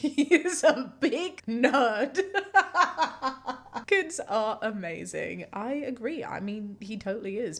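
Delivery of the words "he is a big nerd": slow words bursting with laughter; cracking up. Real, not acted. Host reacting to a piano-practice joke, laughing hard. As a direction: laugh through it, ending in a high giggle.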